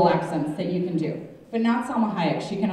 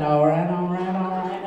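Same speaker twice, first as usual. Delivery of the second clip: McConaughey; 2 semitones lower than usual. Retold or imitated. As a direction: imitate a star's drawling accent. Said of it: "he's got that nice accent".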